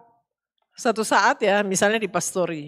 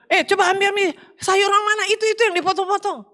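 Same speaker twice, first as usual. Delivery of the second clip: high, brisk, boisterous talk; noisy Manado chatter; 11 semitones higher, faster than usual. Retold or imitated. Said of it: "ngomong rame".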